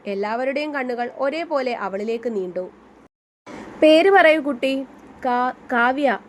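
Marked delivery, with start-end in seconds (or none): 3.8-4.9 louder, slow, stern teacher; teacher calling on her. Acted. Teacher calls on shy student. 5.2-6.2 stammering in fright. Acted. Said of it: "തൊണ്ട വരണ്ടു പോയിരുന്നു".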